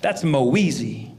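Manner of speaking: slow, drawn-out Moeezy; slow name correction. Retold or imitated. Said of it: "I'm like".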